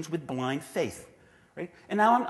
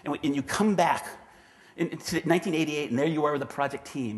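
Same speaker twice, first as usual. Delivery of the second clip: ordinary talk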